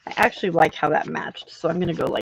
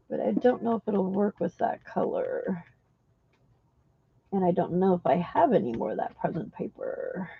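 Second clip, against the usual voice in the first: muffled, facing away downward; talking to the floor; 7 dB quieter than usual. Real, not acted. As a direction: speak down into the floor. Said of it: "Sorry if you can't hear me, I'm talking to the floor".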